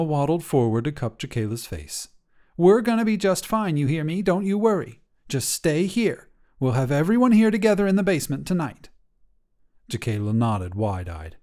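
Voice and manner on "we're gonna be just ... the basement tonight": a hint of grandma comforting her; soothing, then hurrying at the end; lightly voiced reassuring grandma. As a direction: grandmother promising they'll be fine.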